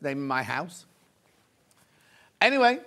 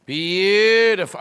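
drawn-out admiring; 2 semitones higher, 5 dB louder, much slower than usual. Real, not acted.

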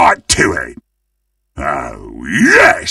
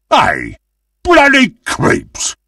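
usual speaking voice

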